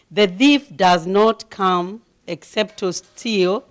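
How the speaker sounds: loud, forceful sermon reading of scripture; loud, slowed, emphatic pulpit reading; preaching scripture reading. Acted. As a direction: proclaim it to the congregation at full voice. Preaches on the verse.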